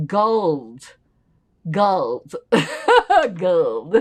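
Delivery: can't stop laughing at the word; laughing at the odd word gold. Real, not acted.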